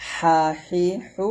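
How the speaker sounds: slow হা, হি, হু squeezed in the throat; narrow, pressed ha. Shown as a demonstration. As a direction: press each হা finely and hold it. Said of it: "চিকুন করে চাপ দিয়ে বলতে হবে". (হা হি হু চিকুন চাপ দিয়ে)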